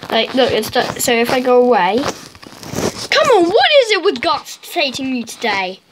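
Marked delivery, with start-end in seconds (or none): none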